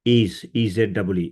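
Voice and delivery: slow letter-by-letter spelling; E Z double E spelled out slowly. Shown as a demonstration. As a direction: spell out the brand name slowly. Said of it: "spelling the brand name".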